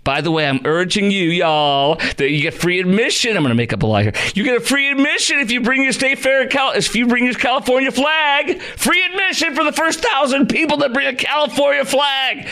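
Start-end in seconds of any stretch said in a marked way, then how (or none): 4.3-12.5 high-pitched, hammed-up promotional pitch; mock promo announcer. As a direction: call out a pretend giveaway, pitched high. Makes up a mock fair promo.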